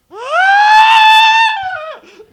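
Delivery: a huge, high, held shriek of terror; terrified scream. Retold or imitated. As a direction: let out a long, high, full-volume horror scream. His scream when fleeing.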